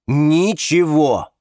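loud, slow, emphatic word; cutting refusal. A narrator's reading. Man forbidding friends to tell anything, emphatically cutting. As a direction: draw it out as a firm order. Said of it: "отрезал Александр".

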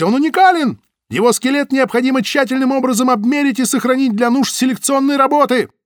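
high, loud desperate shouting. Acted. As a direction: yell it as dragged off. Butovich shouts while dragged away.

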